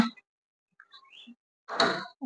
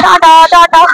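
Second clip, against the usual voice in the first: calling out bye; 5 semitones higher, 7 dB louder, much slower than usual. Real, not acted. Waving bye to passing train.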